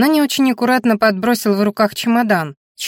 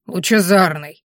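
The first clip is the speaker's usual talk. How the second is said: scornful snort; slower than usual. Acted. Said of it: "фыркнула Дургуза".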